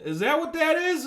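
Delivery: high, loud incredulous shout; hammed-up shouting at a scientist; mock yell. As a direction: yell it up at the giant robot. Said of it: "you're fucking, well, like".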